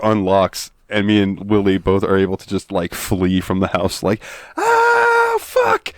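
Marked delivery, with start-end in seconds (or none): 4.6-5.9 very high shrieking curse; hammed-up panicked yelp; mock scream. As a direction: shriek it while running away. Fleeing the haunted house.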